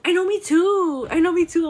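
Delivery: loud, high and bright; excited. Real, not acted. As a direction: gush with excitement. Excited loud reply to chat.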